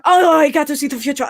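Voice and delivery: mocking imitation. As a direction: mimic someone scoffing at a prediction. Mimics someone mocking predictions.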